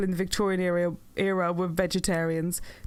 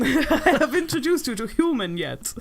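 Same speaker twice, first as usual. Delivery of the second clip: ordinary talk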